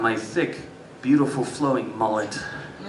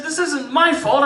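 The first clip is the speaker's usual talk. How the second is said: put-on high-pitched teenage defence; indignant protest; 12 semitones higher than usual. Boy defending himself to his dad, high indignant protest.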